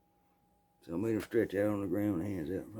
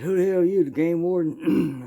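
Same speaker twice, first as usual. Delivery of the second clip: startled thief; 9 semitones higher, 7 dB louder than usual. Retold or imitated.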